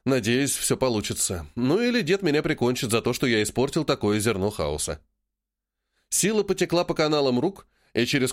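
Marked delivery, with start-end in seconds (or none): none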